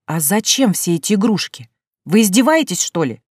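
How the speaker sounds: ordinary delivery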